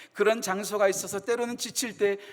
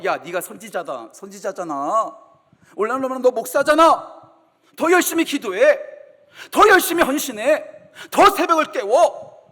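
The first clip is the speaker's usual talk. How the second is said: louder, rising rebuke; imagined scolding; 2 semitones higher, 7 dB louder than usual. Pretend. Imagined God scolding weary Elijah, rising louder rebuke. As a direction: scold a prophet who wants to quit. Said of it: "혼내실 만하잖아요".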